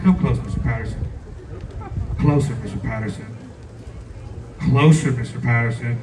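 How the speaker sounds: low, measured come-hither; confidential beckoning. Retold or imitated. Lunch lady beckons the assistant principal, low and confidential. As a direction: draw him in with a dropped pitch. Acted beckoning before revealing a secret.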